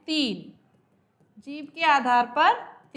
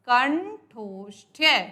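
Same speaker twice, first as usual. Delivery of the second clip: कंठोष्ठ्य said very slowly, syllable by syllable; slow dictation; much slower than usual. Shown as a demonstration. Teacher naming the place of articulation, slow. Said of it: "उच्चारण स्थान आ जाता है".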